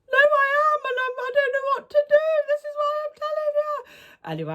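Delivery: very high mock wail; hammed-up tearful squeal; imitated crying. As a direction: wail it out as if bursting into tears. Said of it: "burst out crying. I said".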